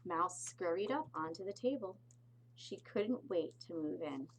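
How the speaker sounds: ordinary delivery, nothing marked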